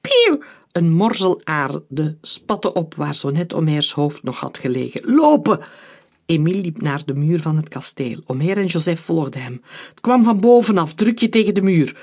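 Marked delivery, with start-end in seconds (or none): 0.0-0.4 very high, loud bullet whizz; whizzing bullet noise. A narrator's reading. Narrator voices a shot striking the earth, high whizz. 5.2-5.6 high, loud order to flee; urgent shouted command. A narrator's reading. Friend orders the others to run under fire, urgent shout. 9.9-12.0 raised, louder voice of alarm; urgent orders. A narrator's reading. One friend directs the others against the wall, urgent.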